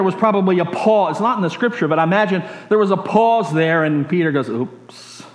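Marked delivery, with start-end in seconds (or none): 4.5-5.2 sheepish whisper. Pretend. Peter catching himself.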